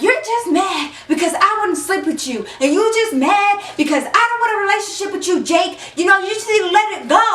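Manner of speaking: throwing a fit. Retold or imitated. Girl making a scene for listening girls, high fast fit. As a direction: make a scene of it. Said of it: "throwing a whole fit".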